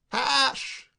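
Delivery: loud, high shout for the servant. A narrator's reading. Sam shouting for the missing Hash, loud and high. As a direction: bellow his name through the house. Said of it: "shouted Sam".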